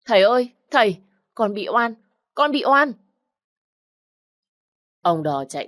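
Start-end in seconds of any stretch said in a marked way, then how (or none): 0.1-1.9 frightened cry, higher and louder; scared girl shouting for help. Acted. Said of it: "nó vẫn gào lên". 2.3-2.9 even higher, louder wail; frightened girl's peak cry of innocence; desperate cry. Acted. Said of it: "nó vẫn gào lên".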